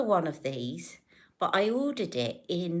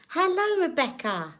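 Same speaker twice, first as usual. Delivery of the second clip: high excited hello; 11 semitones higher, 5 dB louder than usual. Real, not acted. Greeting a new viewer.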